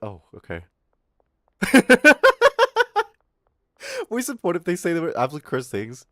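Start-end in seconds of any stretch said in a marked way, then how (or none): 0.0-0.6 boring streamer. Retold or imitated. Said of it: "what he's talking about is like".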